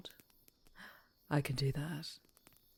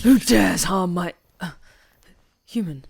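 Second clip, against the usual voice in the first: furious roar; 2 semitones higher, 17 dB louder than usual. Acted. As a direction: roar at whoever dares touch the human. Monster roars at threat.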